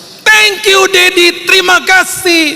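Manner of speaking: very high squealed thanks; child's grateful shout. Retold or imitated. Child hugging Daddy in thanks, high joyful shout. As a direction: cry out thanks like an overjoyed child. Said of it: "Dia berteriak, dia peluk saya".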